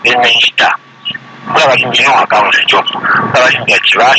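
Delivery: ordinary talk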